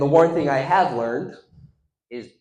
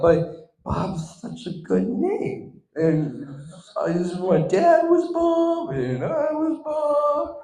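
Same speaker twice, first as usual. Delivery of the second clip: high, slow, pleading little voice; mock whiny Bob; 11 semitones higher, slower than usual. Friend mocking Bob's pleading, high and whiny. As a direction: whimper it like a sulky kid. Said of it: "he's acting all big".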